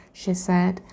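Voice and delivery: usual speaking voice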